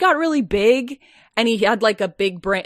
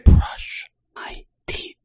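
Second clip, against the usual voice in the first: whispering; 6 semitones lower, slower than usual. Pretend. Continuing whisper joke.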